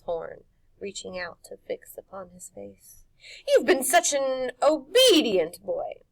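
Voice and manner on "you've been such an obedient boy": slow, loud, higher crooning crone; witch voice. Acted. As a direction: praise him, purring menace. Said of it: "Mar went on".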